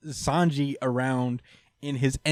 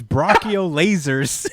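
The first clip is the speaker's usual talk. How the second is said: "Brachio lasers" called slow and loud; slow announced attack; 2 semitones higher, 5 dB louder, slower than usual. Retold or imitated. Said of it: "every time he says".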